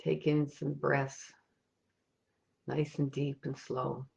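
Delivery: soft, unhurried meditation voice with long pauses; guided meditation. Acted. Meditation leader guiding listeners on an imagined ocean walk, soft and calm. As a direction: lead listeners gently inward, leaving space after each thought.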